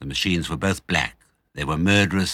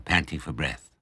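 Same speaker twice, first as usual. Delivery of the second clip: usual speaking voice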